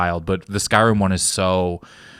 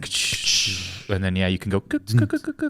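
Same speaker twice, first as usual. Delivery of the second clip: imitated game click; 2 semitones lower, 7 dB quieter than usual. Shown as a demonstration. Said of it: "it's like".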